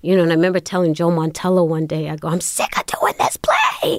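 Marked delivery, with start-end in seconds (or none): 2.3-4.0 high, strained wail, drawn out; mock-desperate, slow and squeezed high; retold fed-up complaint. Telling a director she's done with the play, fed up.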